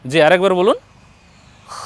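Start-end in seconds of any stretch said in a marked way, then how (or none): 1.7-1.8 খ as a scraped throat fricative, lips not rounded; unrounded throaty kha. Shown as a demonstration. Teacher shows learner the proper way, throaty unrounded খ. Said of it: "উচ্চারণ করার সময় এরকম হবে".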